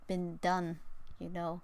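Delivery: usual speaking voice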